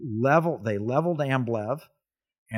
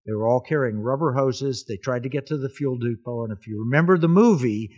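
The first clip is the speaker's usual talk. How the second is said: ordinary talk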